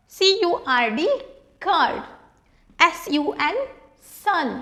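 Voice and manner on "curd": ordinary talk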